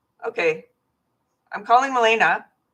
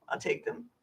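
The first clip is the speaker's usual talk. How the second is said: whisper; 12 dB quieter than usual. Real, not acted. Aside about her medication.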